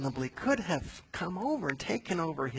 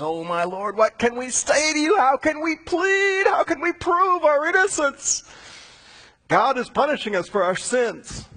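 desperate plea; 12 semitones higher, 7 dB louder than usual. Acted. Judah pleading with Joseph for mercy, desperate and loud. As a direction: beg the lord for mercy.

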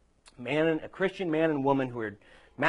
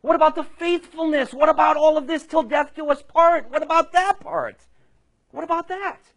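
high, loud acted outburst; indignant exclaiming; 10 dB louder than usual. Soon-to-be wife demands love and commitment from groom, indignant. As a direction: cry out each question, outraged, voice way up. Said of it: "wife is indignant." She exclaims".

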